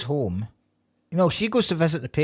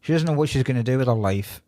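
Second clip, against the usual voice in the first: ordinary delivery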